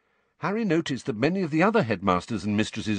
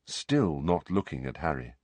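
ordinary talk

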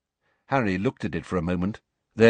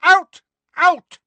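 much higher, much louder shrieked orders; screaming out; 10 dB louder, slower than usual. Acted. Madam Pince screams at Harry and Ginny to get out, very loud. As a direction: hurl them out of the library. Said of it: "she screamed".

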